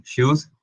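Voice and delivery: shoes said slowly with a hushed SH; English SH sound. Shown as a demonstration. Teacher demonstrating the SH in shoes to the class. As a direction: draw out the SH in shoes. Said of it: "el sonido de la S H".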